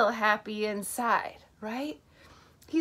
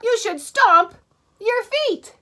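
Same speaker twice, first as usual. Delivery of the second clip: loud, higher elephant call; stomping elephant; 10 semitones higher, 7 dB louder, slower than usual. A narrator's reading. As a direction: boom it out like an elephant. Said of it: "what the elephant's saying".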